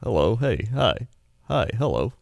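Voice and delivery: ordinary talk, nothing marked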